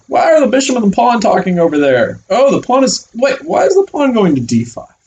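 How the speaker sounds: high, curious voice of a wondering chess knight; puzzled knight. Acted. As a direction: play the knight squinting at the board, baffled.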